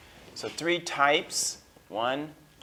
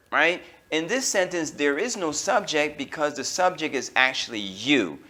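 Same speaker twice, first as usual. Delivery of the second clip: normal talking voice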